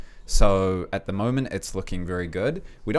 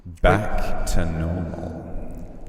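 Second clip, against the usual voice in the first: sexy voice; much slower than usual. Pretend. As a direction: drag it out seductively. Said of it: "in the sexy voice?".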